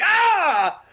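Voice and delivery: high loud yelp of pain from tea in the face; hammed-up scream. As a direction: shriek as the hot tea hits. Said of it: "He's like".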